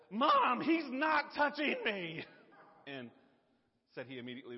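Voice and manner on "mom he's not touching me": high, loud, slow whine; whiny tattling little sibling. Retold or imitated. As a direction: whine to Mom like a little kid. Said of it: "the guy says".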